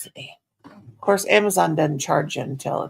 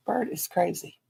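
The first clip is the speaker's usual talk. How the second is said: usual speaking voice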